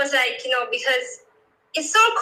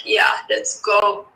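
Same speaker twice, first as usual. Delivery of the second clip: slow, lower, laughing; 5 semitones lower, slower than usual. Retold or imitated. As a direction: savour it with a laugh. Recalls excitement, ends laughing.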